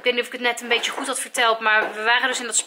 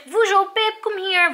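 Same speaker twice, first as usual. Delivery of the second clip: high-pitched re-enacted call to the dogs; retold calling; 7 semitones higher, slower than usual. Vlogger retelling how the dogs were called back, high mock call. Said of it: "Dus ik riep ze".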